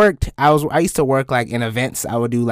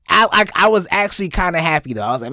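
normal talking voice

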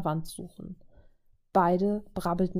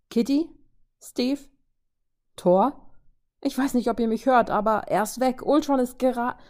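higher and louder, calling names over the radio; urgent calling; 5 semitones higher, 5 dB louder than usual. Acted. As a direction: shout through the comms before being cut off. Clint calls urgently over the radio.

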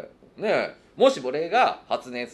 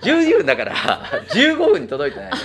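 laughing; 9 dB louder than usual. Real, not acted. Punchline about the twelve-minute set.

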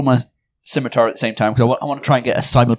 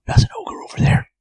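whispering. Real, not acted. Spotting an ogre, staying hidden.

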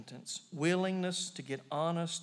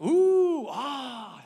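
crowd oohing; 7 semitones higher, 7 dB louder than usual. Retold or imitated. Crowd marvels at swinging monkey.

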